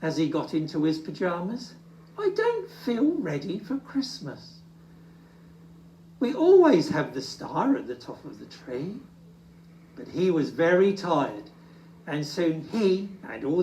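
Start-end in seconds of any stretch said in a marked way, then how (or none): 2.2-9.0 lightly sad little bear. Acted. Little Bear at bedtime, mildly sad. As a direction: mope a bit. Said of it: "said Little Bear sadly".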